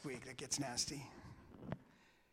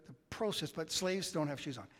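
normal talking voice

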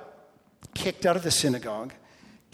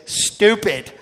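louder, higher, a blunt imitated heckle; mock blurt; 6 semitones higher, 10 dB louder than usual. Pastor echoing Rob's heckle from the crowd, loud mock blurt. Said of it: "Rob just gently said".

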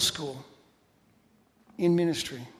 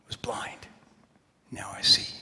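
whispered hymn line. Acted. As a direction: breathe the words, no voice.

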